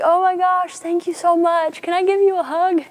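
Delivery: high, loud gushing delight; gushing Carol. Retold or imitated. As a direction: imitate a woman overjoyed at a kind stranger. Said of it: "And she's like".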